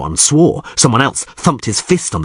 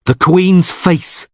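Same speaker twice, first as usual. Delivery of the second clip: exclaimed; 10 semitones higher, much slower than usual. Acted. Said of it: "exclaimed Erkion".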